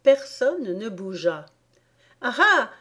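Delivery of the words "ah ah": high, louder mocking "ah ah"; sneering laugh. A narrator's reading. Alice scoffing at the queen's threat, sneering. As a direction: scoff with a spoken mock laugh. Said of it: "ricana Alice".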